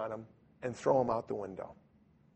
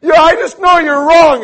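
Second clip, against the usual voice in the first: very loud, much higher, near-crying shout; furious yell; 20 dB louder than usual. Retold or imitated. Losing to the elder, furious near-crying yell. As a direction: howl it in helpless rage. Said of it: "getting madder and angrier".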